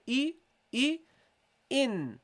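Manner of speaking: short "E" sliding into "in"; hamza joined to noon. Shown as a demonstration. Teacher shows a student the joined noon, held. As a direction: glide the E straight into "in". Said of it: "attach the hamza to the noon".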